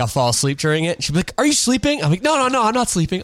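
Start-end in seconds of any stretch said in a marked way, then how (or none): 1.4-2.0 high-pitched imitated question; imitated questioning girlfriend. Girlfriend to dozing boyfriend during a movie, imitated questioning. Voices girlfriend catching him asleep. 2.2-3.2 high, hurried-sounding string of denials; mock protesting denial. Dozing boyfriend to girlfriend during a movie, mock protesting.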